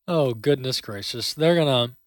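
normal talking voice

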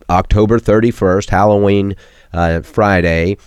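ordinary talk, nothing marked

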